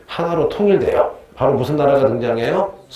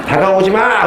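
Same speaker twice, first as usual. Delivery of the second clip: mock yell; 6 semitones higher, 5 dB louder than usual. Acting student spraying bug.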